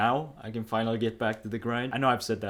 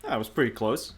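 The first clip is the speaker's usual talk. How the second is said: ordinary talk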